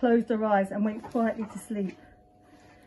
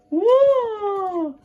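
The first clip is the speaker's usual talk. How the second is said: much higher loud crying wail; baby wail; 11 semitones higher, 6 dB louder than usual. Acted. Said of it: "he began to cry".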